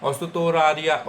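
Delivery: ओसुतोरारिया spelled out slowly, o-su-to-ra-ri-ya; slow careful ओसुतोरारिया; slow Japanese demo. Shown as a demonstration. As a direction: draw out each syllable of ओसुतोरारिया for learners. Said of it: "Australia को बोलेंगे ओसुतोरिया".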